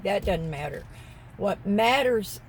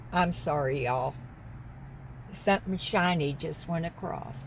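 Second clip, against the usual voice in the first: normal talking voice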